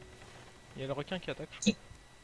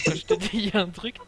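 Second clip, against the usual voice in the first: exasperated; 4 semitones higher, 10 dB louder than usual. Real, not acted. Exasperated at teammate not listening.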